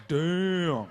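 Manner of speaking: one long drawn-out "damn"; impressed crowd reaction. Acted. Whole party reacts to a knockout, drawn-out awe. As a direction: stretch it out like an awed party crowd.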